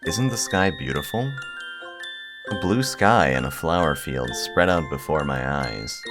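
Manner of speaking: normal talking voice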